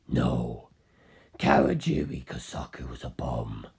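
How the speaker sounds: deep low voice for Carl contradicting the detective; Carl's voice. Acted. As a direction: drop the voice far down and take it slowly.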